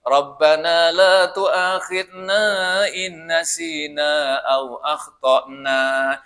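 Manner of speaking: slow chanted Arabic recitation; Quranic recitation. Acted. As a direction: intone the verse slowly, chanting.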